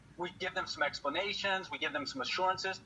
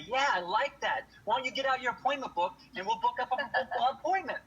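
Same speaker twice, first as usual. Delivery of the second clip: eager customer; 6 semitones higher than usual. Retold or imitated.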